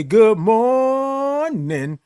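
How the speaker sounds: high, long-held "morning", stretched on the first syllable; big high stretched-out morning greeting; drawn-out greeting. Real, not acted. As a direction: sing out "good morning", holding "mor-" very long. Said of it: "I love all them O's and R's and I N Gs".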